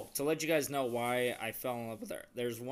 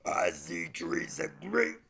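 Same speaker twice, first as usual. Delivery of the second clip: slow, raspy-styled crooning impression, higher and louder; 4 semitones higher, 6 dB louder, much slower than usual. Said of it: "I can do a [name]".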